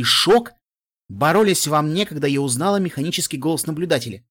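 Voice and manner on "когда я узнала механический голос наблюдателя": plain reading voice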